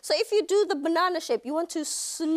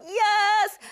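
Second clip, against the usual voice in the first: mock excited yes; 8 semitones higher, 5 dB louder than usual. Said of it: "I'm like".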